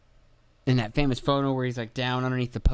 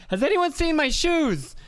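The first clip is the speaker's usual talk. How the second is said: Dane Cook bit. Retold or imitated. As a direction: ham it up like a stand-up routine. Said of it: "Sounds like a Dane Cook joke".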